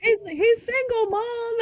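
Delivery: put-on kid voice. Quoting kid yelling to mom.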